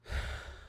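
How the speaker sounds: a long breathy sigh out; weary sigh. Retold or imitated. Dad sighing at another costly request, weary sigh. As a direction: let the air out, resigned.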